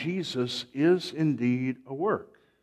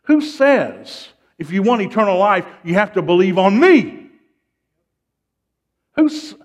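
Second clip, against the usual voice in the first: loud, high pulpit oratory; raised, emphatic preacher's voice; full-force preaching; 8 semitones higher, 8 dB louder than usual. Real, not acted. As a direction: drive the point home to a ringing climax.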